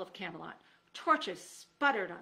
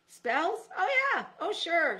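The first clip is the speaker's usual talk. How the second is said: much higher, louder overeager yes; eager bluff; 10 semitones higher, 9 dB louder, slower than usual. Acted. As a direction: jump in with forced enthusiasm.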